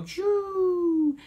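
sudden loud high whoosh; playful coaster plunge sound; roller coaster whoosh. Shown as a demonstration. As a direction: shoot way up and drop on it. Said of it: "down you go".

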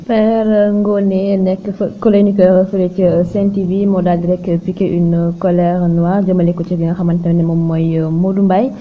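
normal talking voice

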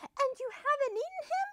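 very high, quick question; Missus Duck. A narrator's reading. Missus Duck questioning the wolf about her duckling, high and suspicious. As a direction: squeak out a suspicious check. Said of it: "asked Missus Duck".

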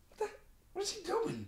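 shocked, voice jumping high; what the, in a high disbelieving squeak; baffled high squeak. Retold or imitated. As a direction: say it like you can't believe what you're hearing. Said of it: "I go".

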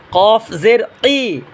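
"কফ জের কি" drawn out with heavy back q; slow "qof zer qi", q at tongue root; thick QAF. Shown as a demonstration. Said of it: "এটা মোটা আওয়াজ".

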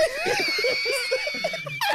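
high, loud hysterical laugh; shrieking laughter. Real, not acted. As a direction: burst out in helpless shrieks of laughter.

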